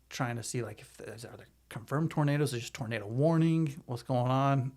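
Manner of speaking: ordinary talk, nothing marked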